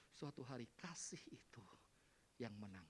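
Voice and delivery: low, near-whispered promise; hushed hope. Acted. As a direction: drop to a soft, confiding hush. Hushed hope after loud preaching.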